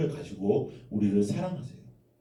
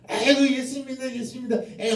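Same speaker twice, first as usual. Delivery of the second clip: high, laughing taunt; teasing; 11 semitones higher than usual. Acted. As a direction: tease a friend singsong. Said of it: "하면서 막 놀렸다고 생각해봐".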